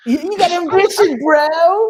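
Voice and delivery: parroting. Retold or imitated. Parroting the coworker's phrase.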